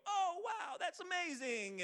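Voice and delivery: high-pitched, hammed-up gushing reaction; mock amazement. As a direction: gush in an exaggerated high voice, imitating a thrilled winner. Mimics draftees reacting on camera.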